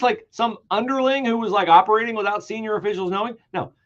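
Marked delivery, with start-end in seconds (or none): none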